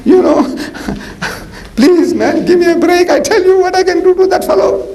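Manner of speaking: high, pleading excited voice; eager shepherd boy. Retold or imitated. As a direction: beg to be let fight, bubbling over. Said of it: "He says".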